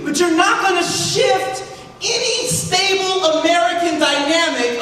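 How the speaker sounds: heated, high-pitched exhortation; retold impassioned yelling. Consultant pushing an official to go public, retold impassioned yelling. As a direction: re-enact a fired-up challenge, punching each demand. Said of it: "I'm sorry, I'm yelling".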